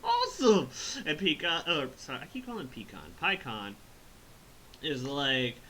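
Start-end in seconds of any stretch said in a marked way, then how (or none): 0.0-0.7 high, loud hammed-up glee; excited. Goku finally getting to fight, hammed-up excitement. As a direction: act thrilled in a put-on high voice. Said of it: "Goku gets excited".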